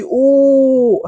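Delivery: "أو" as a long loud ooo; madd with waw. Shown as a demonstration. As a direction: hold the vowel "أو" out slowly. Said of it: "هذا مد بالواو". ("أو" واو ممدودة طويلاً)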